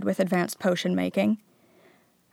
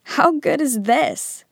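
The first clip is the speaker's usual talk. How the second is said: higher, drawn-out delight; enthusiastic; 7 semitones higher, 4 dB louder, slower than usual. A narrator's reading. Harry turning to his friends, enthusiastic. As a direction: relish every word with enthusiasm. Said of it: "said Harry enthusiastically".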